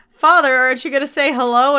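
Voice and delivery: a hint of an eager kid prodding her father; young Leia.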